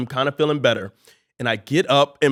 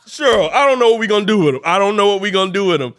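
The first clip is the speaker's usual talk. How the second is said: worried dad; 5 semitones higher, 6 dB louder, faster than usual. Retold or imitated. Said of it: "he's like".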